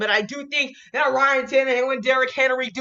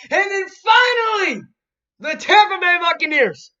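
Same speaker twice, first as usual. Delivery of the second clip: hype shout; 9 semitones higher, 6 dB louder than usual. Real, not acted. Vlogger hyping his final pick to viewers, excited shout. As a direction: announce it like a big reveal.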